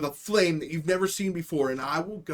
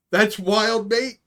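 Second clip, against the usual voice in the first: normal talking voice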